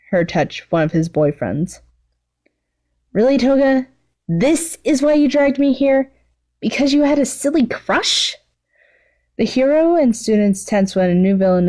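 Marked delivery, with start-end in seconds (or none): 3.1-8.4 higher, exasperated villainess; rolling her eyes at her friend; a higher, exasperated voice for the bored villainess; Mimicus's voice.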